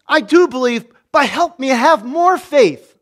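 high, loud, slowed plea in an imitated father's voice; urgent plea. A preacher voices the father begging Jesus, high and urgent. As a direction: push the words up high, begging.

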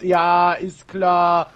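laut, gedehnt und spöttisch zustimmen; loud mock agreement, drawn out; sarkastisch. Real, not acted. Sarcastic reply in banter. (sarkastisch und laut)

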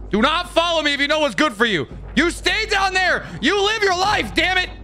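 yelling. Real, not acted. Yelling at chasing creature.

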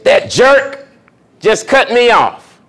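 mock road rage at the jerk; irritated driver. Driver cut off in traffic, snapping in mock irritation. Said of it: "the first thing you say".

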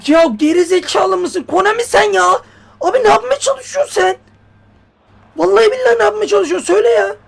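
very high, loud raging yell; furious shouting. Real, not acted. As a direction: scream at the game in disbelief. Oyuna öfkeyle bağırıyor. (çok yüksek, öfkeli bağırış)

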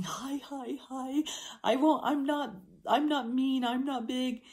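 high-pitched, eager puppy voice; submissive little puppy. Acted. Little puppy scraping its belly to greet someone, high submissive voice. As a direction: pitch it way up like a harmless, wriggling puppy. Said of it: "of a little puppy".

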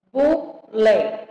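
"Bule" in two spaced beats, bu-le; syllable by syllable. Shown as a demonstration. Teacher separating words for pupils, syllable by syllable. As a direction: split "Bule" with a gap between syllables. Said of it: "separação de palavras e sílabas".

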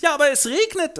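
sehr hoch und laut, beleidigt. Acted. Schwester beschwert sich. (hohe, quengelnde Stimme)

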